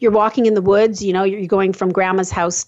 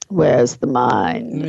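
low, slow spooky question; mock dramatic awe; 8 semitones lower, slower than usual. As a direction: intone it like a mystic.

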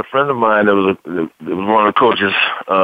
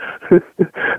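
laugh; 8 semitones higher than usual. Real, not acted. Laughing about coaches visiting home.

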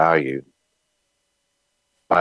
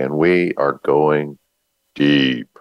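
deep voice; 2 semitones lower, slower than usual. Shown as a demonstration. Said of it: "that's my deep voice".

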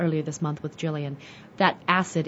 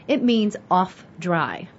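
ordinary delivery

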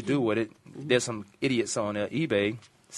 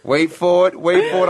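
teasing buildup; 7 semitones higher, 10 dB louder than usual. Acted. Repeats the buildup while laughing.

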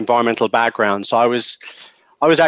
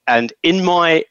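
usual speaking voice

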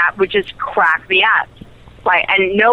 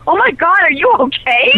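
much higher, worried co-star voice; mock alarm; 10 semitones higher than usual. Co-star fearing she is hurt, high alarmed voice. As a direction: play him suddenly alarmed for her. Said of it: "And he'd be like".

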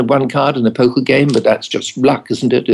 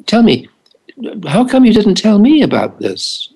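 higher-pitched, indignant questioning; put-out questioning; 8 semitones higher than usual. Retold or imitated. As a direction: act out a put-out doctor demanding to know. Doctor confronts his patients in retelling.

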